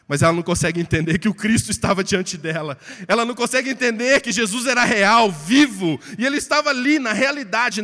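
loud, rising preacher's proclamation; preaching at full force. Real, not acted. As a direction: build it up, hammer each line. (pregação com força total, cada vez mais alto)

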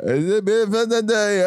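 slow, mumbled Scouse "It is beautiful today."; mock Liverpool accent. Joking that Scousers can't be understood, mumbled Scouse. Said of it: "no se le entiende nada".

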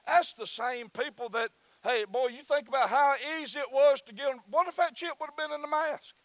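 loud, high preacher's cry; full-voiced revival preaching; preaching at full force. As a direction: lift the congregation, shouting the word.

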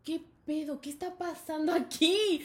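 stretched mock-stunned question; bewildered classmates. Whole class after the insult, slow high bewilderment. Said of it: "Y todos".

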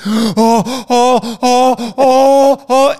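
loud, high panicked gasps; mock hyperventilation, loud and high; imitated hyperventilating. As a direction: heave big frantic breaths like a panicking kid. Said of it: "He instantly started hyperventilating".

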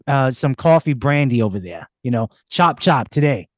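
pushy Bostonian sending someone on an errand; hammed-up Boston street talk; Boston accent. Shown as a demonstration. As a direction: boss someone about in a thick Boston accent. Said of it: "Boston, take, take one, action".

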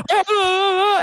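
high, loud imitated scream; comic high-pitched shriek; mock freak-out scream. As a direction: scream like an outraged parent. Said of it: "pronoun parents fucking flipping out".

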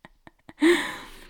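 riso leve e soprado; riso envergonhado. Real, not acted. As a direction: solta uma gargalhada envergonhada. Riso depois de se emocionar. (riso soprado depois da emoção)

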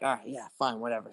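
quiet, low, dismissive giving-in; retold resigned agreement. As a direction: imitate going along with it, voice dropped. Retold grudging giving in to friend.

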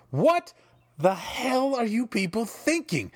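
furious fan yelling at WWE; outraged. Real, not acted. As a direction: blurt the outrage at the bookers. Raging at WWE bookers.

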